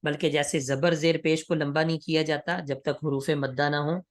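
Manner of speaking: usual speaking voice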